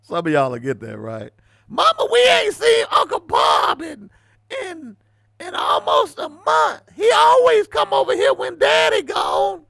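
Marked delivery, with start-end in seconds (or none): 1.7-9.6 put-on high crying child's shout. A child crying to mama about Uncle Bob, high wailing shout. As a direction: wail it like a little kid asking for Uncle Bob.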